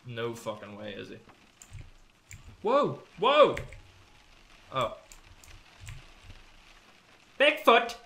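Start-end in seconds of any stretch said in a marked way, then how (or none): 2.6-8.0 high, loud startled cries; startled shout. Real, not acted. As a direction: shout in shock at the sight. Sights Bigfoot, startled.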